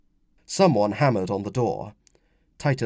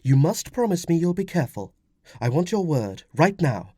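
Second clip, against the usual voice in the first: higher woman's voice, insistent; demanding wife; 7 semitones higher, 3 dB louder than usual. Acted. Wife demands husband's word, insistent. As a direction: demand a solemn promise.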